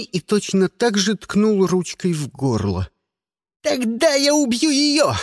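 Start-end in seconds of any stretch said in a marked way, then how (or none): 3.6-5.1 high, frantic shout; strained, furious high cry; shouted threat. A narrator's reading. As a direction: yell it like a cornered kid. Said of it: "крикнул он".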